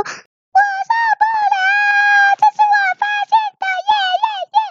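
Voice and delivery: very high, frantic little giraffe cheering; overexcited yelling. A narrator's reading. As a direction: squeal in celebration at a fixed high pitch. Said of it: "你到现在还这样大喊大叫".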